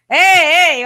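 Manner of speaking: high, loud drawn-out hey; retold character greeting. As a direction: call back cheekily in character.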